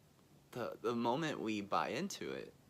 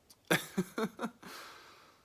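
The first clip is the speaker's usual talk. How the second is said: high breathy laugh; 13 semitones higher, 7 dB louder than usual. Real, not acted. Laughing at own image.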